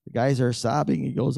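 ordinary talk

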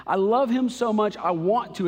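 loud and high, building; passionate cry. Real, not acted. Preacher to congregation, crying out his love, loud and high. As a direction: cry it out to the room. Repeats the climax, even higher.

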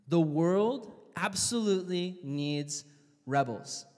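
normal talking voice

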